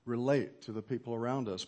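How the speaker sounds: ordinary talk, nothing marked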